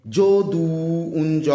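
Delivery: যদু with a held ending, then a long জ in উঞ্জ; teacher lingering on the vowels of যদু and উঞ্জ; sounding out. Shown as a demonstration. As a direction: break down যদু, stretching the vowels. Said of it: "কি আসছে?".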